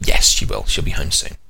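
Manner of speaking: put-on whispered, gentle reply to a child; father whispering comfort to his daughter. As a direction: hush her kindly. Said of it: "And I'm saying".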